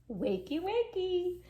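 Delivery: rising playful call; sing-song wake-up. Acted. Dog waking up dad, sing-song wakey wakey. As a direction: coax someone awake.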